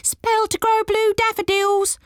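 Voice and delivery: high, odd gnome calling wares; gnome hawker cry. Acted. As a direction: call out like a little market gnome. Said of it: "cried a gnome".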